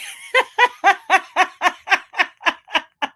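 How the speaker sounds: loud, high rattling laugh; long, high cackling laugh; big laugh. Real, not acted. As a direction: burst into long real laughter. Laughs at the banana bread comment.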